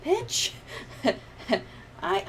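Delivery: normal talking voice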